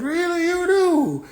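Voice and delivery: squealed, drawn-out mock reply; hammed-up high-pitched question. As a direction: echo the caricature, high and loud. Echoes her put-on voice in reply.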